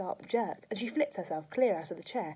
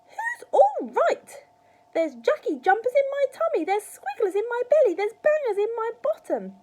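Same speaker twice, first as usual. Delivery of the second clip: high, very loud outraged wail; yelled; 13 dB louder than usual. A narrator's reading. Grandma complaining of her rumbling insides, yelling. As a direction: screech the complaints one after another. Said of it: "she yelled".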